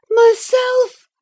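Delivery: high, loud gasp of shock. A narrator's reading. Catherine sees herself in the glass, high gasp. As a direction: gasp the word in horror. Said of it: "she gasped".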